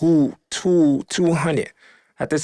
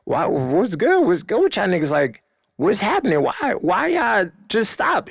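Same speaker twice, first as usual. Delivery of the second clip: high, pleading questions, mock panic; retold alarm; 9 semitones higher than usual. Storyteller replays confronting the crowd, high-pitched alarm. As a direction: voice jumps up in alarm. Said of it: "I get scared for myself and him".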